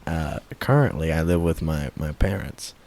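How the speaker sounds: low, slow, creepy drawl. Acted. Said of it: "Stop talking like that. Talk normally".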